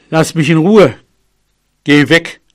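loud, firm elephant rebuff; annoyed. Acted. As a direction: send the pest away firmly. Annoyed elephant shoves mouse away. (genervt und laut abweisend)

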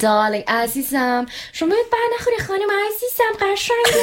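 rapid, high, syrupy fake politeness; fake polite Farsi. Shown as a demonstration. Performed on request, sickly sweet fake politeness in Farsi. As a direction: gush over her with exaggerated fake sweetness. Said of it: "Say it to me in Farsi".

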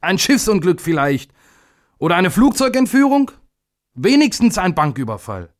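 high-pitched, agitated demanding voice; indignant outburst. A narrator's reading. Father demanding big news from little day, indignant. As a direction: press for something sensational, exasperated and high. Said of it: "rief sein Vater aufgebracht".

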